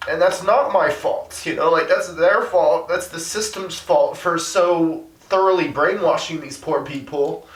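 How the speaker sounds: high, upset voice on the edge of tears; emotional frustration. Real, not acted. Activist blaming the system for brainwashing people, upset and frustrated. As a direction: insist on it, pitched high with real feeling. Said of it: "it's also frustrating".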